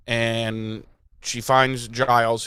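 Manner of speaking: ordinary talk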